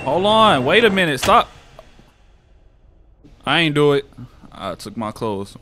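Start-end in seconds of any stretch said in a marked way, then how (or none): 0.0-4.0 loud, high alarmed protest; real startled yelling; startled shout. Real, not acted. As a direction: yell it out, caught off guard. Startled by something in game.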